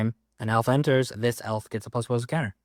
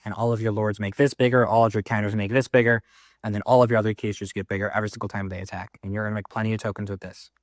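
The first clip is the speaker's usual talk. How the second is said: ordinary delivery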